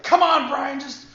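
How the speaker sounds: high, loud pleading shout; retold doubling down. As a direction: shout it, pushing the host harder. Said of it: "I doubled down".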